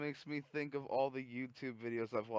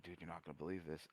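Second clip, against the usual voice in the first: hushed confiding; 5 semitones lower, 13 dB quieter, faster than usual. Retold or imitated. Confiding to the employee.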